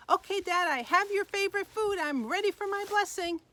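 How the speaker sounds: high, loud, excited son voice; eager storybook son bursting in. A narrator's reading. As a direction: announce it proudly to your dad. Esau eagerly brings food to father.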